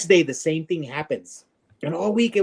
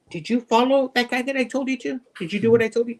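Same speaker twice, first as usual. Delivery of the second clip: higher pressing question; insistent barber; 9 semitones higher than usual. Retold or imitated. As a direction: push the boy for an answer. Barber questions shoe shine boy.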